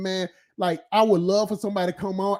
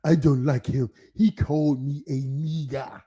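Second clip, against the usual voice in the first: lower, heavy African accent, imitating a coworker; coworker's complaint in a lowered African accent; 6 semitones lower than usual. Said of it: "I gotta say it like him".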